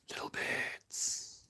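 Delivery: slow, drawn-out whisper; hushed, lingering little aside; whispered echo. Pretend. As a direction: whisper it playfully, stretching each word. Playful echo of own words.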